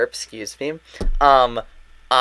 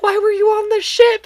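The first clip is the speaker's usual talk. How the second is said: high squeaky gushing voice; squealing gush; 7 dB louder than usual. Retold or imitated. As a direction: squeal it out adoringly. Gushing at a cute character.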